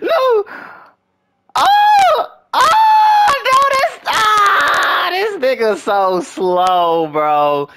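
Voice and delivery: high, loud wail of despair; anguished yelling. Real, not acted. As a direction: scream in agony, sliding down to a groan. Sure touchdown slips away.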